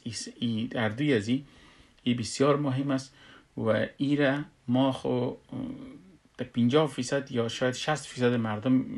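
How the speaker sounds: ordinary talk, nothing marked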